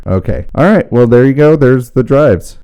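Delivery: very loud overdriven voice; harsh drive effect. Shown as a demonstration. Wrapping up the drives demo, loud and harsh. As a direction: blast it through hard drive. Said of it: "A little harsh here".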